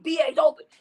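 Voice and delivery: retold excited shout; excited yell. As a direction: shout it like the receiver is wide open. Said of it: "I was like".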